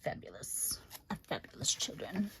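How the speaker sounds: soft whispered aside. Real, not acted. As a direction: breathe the words out, unvoiced. Soft aside about her kids.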